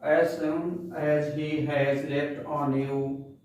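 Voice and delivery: very slow, measured poem reading; poem recitation. Shown as a demonstration. Teacher reciting the poem for class ten, slow recitation. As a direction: recite each phrase slowly with pauses.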